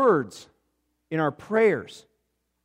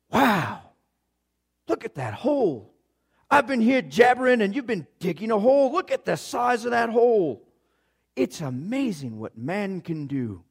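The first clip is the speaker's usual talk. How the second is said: amazed older man in a put-on accent; Charles Siegel; 6 semitones higher than usual. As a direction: marvel in an exaggerated accent. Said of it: "got this incredible accent".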